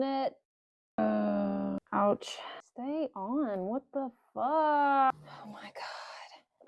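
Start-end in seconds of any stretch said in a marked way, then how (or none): 3.7-5.1 slow, higher exasperated what-the; exasperated outburst. Real, not acted. Mechanic loses the part again, exasperated. 5.1-6.7 whispered, slow despairing oh my God; exasperated whisper. Real, not acted. Fed-up mechanic whispers to himself, despairing. Fighting parts with cold hands.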